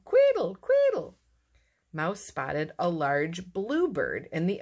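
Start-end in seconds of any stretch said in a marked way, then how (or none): 0.0-1.2 very high, loud chirps; bird song. A narrator's reading. A bird answers the whistle, high loud chirping. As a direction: squeal it back like a jay. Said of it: "a bird sang".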